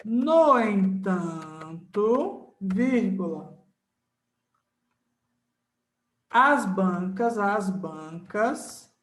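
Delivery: slow word-by-word dictation; teacher dictates for notes; dictating slowly. Shown as a demonstration. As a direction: spell out the exception for notes. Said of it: "dictating the rule".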